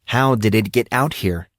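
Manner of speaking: usual speaking voice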